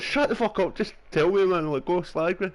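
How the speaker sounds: a hammed-up outburst at a coworker; mock raging. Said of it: "I was pure raging".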